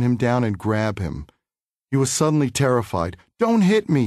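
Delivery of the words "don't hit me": high, loud frightened plea; frightened cry. Retold or imitated. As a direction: squeal it like a scared rabbit. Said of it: "he cried".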